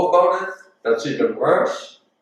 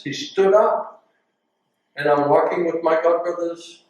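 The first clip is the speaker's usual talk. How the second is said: ordinary delivery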